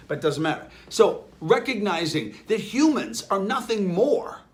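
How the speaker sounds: ordinary delivery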